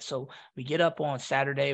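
ordinary talk, nothing marked

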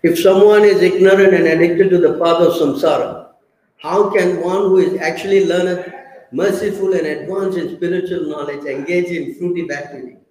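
reading the verse purport out loud to the room; loud scripture reading. Acted. Preacher reading the purport aloud to devotees, loud and declaiming. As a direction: declaim the text loudly like a preacher.

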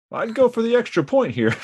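high put-on coach commentator voice; John Madden voice. Podcaster mimicking the football coach, put-on commentator voice. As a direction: play the old coach giving advice. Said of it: "I don't know what that accent was".

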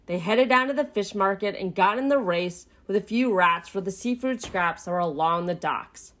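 ordinary delivery, nothing marked

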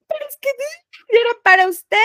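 voz muy aguda, quebrada de llanto; llorando nerviosa. Retold or imitated. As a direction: suplica entre lágrimas, más fuerte. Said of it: "yo estaba nerviosa". (empleada nerviosa al jefe, voz aguda de llanto)

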